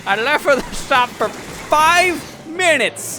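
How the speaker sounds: slow, loud, high put-upon voice; exasperated parent. Pretend. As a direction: drag it out like a fed-up mom.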